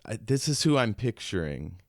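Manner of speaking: ordinary talk, nothing marked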